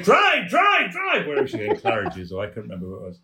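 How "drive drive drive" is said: high, loud, urgent cries to the driver; frantic shouting. Retold or imitated. Barbara yells at the taxi driver, high frantic shouts. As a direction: yell at the cab driver to go, now. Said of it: "While Barbara's going".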